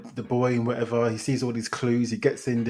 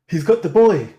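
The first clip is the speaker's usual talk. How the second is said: louder, higher call over the shoulder to his wife; hammed-up panicked dad yelling back; dad running out, shouting back to his wife, mock-loud; 5 semitones higher, 7 dB louder than usual. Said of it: "he shouts back to his wife".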